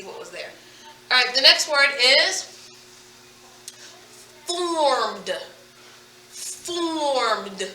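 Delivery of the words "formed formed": formed drawn out slowly with a final D; stretched-out dictation. Shown as a demonstration. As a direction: stretch the vowel in formed, then release the D. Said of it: "What is the last sound you hear".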